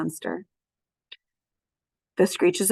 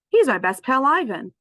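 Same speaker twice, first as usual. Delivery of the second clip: proud claim; 6 semitones higher, 6 dB louder than usual. Acted. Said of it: "Oh, he's my best pal".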